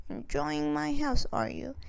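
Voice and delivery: lightly voiced wicked witch. A narrator's reading. Witch greets children.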